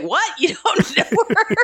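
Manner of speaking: high, laughing mock astonishment; mock disbelief. Clerk reacting to the flirt, high laughing disbelief. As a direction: squeak it out in fake shock. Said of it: "the clerk's like".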